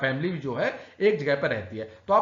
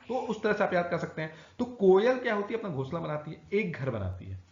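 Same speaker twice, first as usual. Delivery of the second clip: usual speaking voice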